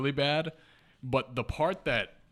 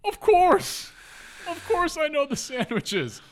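high, loud exclamation of mock confidence; overconfident bluff; 6 dB louder than usual. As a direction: blurt it loud and high, far too sure of yourself. Bluffing to the boss.

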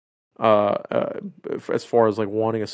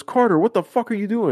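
shocked accusation; 8 semitones higher than usual. Retold or imitated. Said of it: "And he goes".

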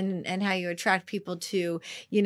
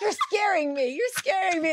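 put-on high frightened child pleading; a hint of a scared kid; 11 semitones higher than usual. Kids scared by mom.

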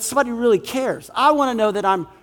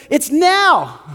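high, urgent demand; higher-pitched pressing insistence; voice of the world; 6 semitones higher, 3 dB louder than usual. Acted. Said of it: "the world comes in and says".

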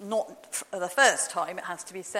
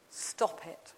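softer echo; 5 semitones lower, 8 dB quieter than usual. Retold or imitated. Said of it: "then Jesus says to you this".

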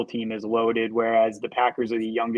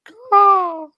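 high, loud raven caw; cawing like a crow; 11 dB louder than usual. Pretend. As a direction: squawk it out like a raven. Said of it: "Give me a little Ravens caw".

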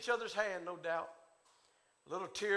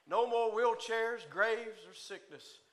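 ordinary delivery

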